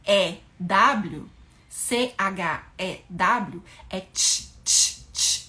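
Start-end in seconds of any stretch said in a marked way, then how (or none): 3.9-5.5 "ch" as an isolated, voiceless burst; CH sound. Shown as a demonstration. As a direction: demonstrate a crisp "ch", repeated on its own. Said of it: "Aí é esse som de ch".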